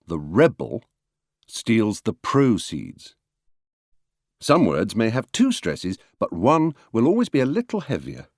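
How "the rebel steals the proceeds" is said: "rebel" and "proceeds" pushed on first syllables; noun stress. Shown as a demonstration. Lecturer showing stress by meaning, nouns "rebel" and "proceeds" stressed at the start. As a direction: say REB-el and PRO-ceeds, slowly. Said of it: "stress will change according to the meaning".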